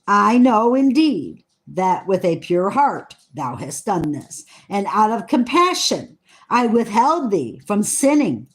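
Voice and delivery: measured, solemn Bible reading voice; scripture reading. Acted. Reading Genesis to her listeners, in a solemn reading voice. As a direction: read the old words with weight and care.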